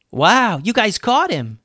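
high, upbeat amazed voice; impressed Dunbar. A narrator's reading. Dunbar arriving at the scene of the capture, impressed. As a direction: exclaim it high with lively swoops.

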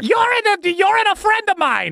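high, whining holler; exasperated spirit. Acted. Spirit finds itself possessing again, wailing in exasperation. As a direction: wail it in dismay.

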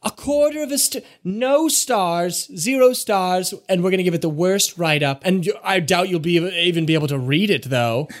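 a pompous critic tearing them apart; haughty reviewer scoffing; scathing critic. Acted.

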